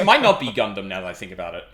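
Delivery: ordinary talk, nothing marked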